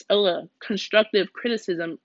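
usual speaking voice

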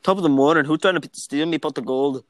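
hammed-up Irish leprechaun accent; a bad stage Irish leprechaun voice. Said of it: "That was a worse leprechaun accent".

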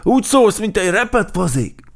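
high, loud critical cheetah voice; loudly pan the performance, pitched high; Gepárd Géza criticizing. A narrator's reading. Cheetah mocking the crow's singing, loudly critical. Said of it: "mondta neki Gepárd Géza".

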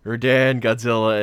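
hammed-up planning voice; mock movie voice. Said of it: "'Cause it's like".

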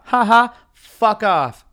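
forced loud ha-ha, then a brush-off; fake laugh. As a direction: fake a laugh and dismiss them loudly. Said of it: "that fucking fake laugh".

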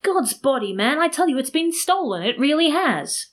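high, exasperated protest; narrator's high-pitched mock shout; mild acted shout. A narrator's reading. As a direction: insist loudly, pitch climbing, dragging the end. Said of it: "began to shout even louder".

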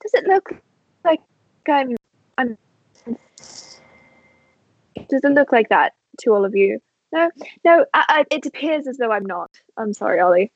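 a nervous high voice asking the party; flustered character. Acted. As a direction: stumble through it, wanting reassurance.

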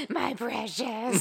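slow, hissing Gollum. Acted. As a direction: croon it like Gollum. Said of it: "Gollum flashbacks".

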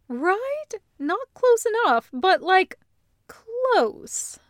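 high, lively, chummy agreement; Loop's bright voice. Acted. Loop agreeing with her brother, high and lively. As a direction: pitch it up like an excited sister. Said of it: "Loop says".

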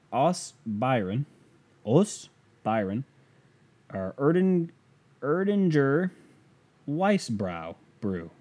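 halting, slow attempt at "Erdinger Weissbrau"; unsure German. Real, not acted. Vlogger unsure reading a German beer label, slowly. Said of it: "I don't speak German, so I'm gonna try".

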